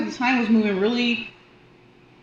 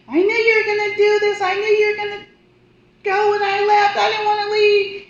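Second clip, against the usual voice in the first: high, loud grieving plea; a much higher, louder voice for the grieving daughter; anguished cry; 14 semitones higher, 7 dB louder than usual. Retold or imitated. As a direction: wail at her dead mother. Grieving mother cries out.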